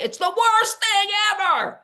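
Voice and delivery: very high, loud dramatic whine; mock teen wail. Teenager protesting a chore, hammed-up high loud wail. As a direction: whine it like a put-upon teenager. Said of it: "And they're like".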